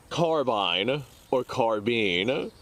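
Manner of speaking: Carbine or carbine, set slowly side by side; two pronunciations. Shown as a demonstration. Contrasting the two carbine pronunciations for viewers, slow and deliberate. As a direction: lay out both ways of saying carbine slowly. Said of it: "this is a very contradicting one".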